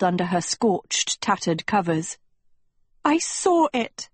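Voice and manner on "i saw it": high, drawn-out accusation; accusing hiss. A narrator's reading. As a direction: hiss it slowly, pitched high. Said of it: "Sophie hissed".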